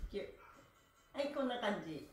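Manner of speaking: ordinary talk, nothing marked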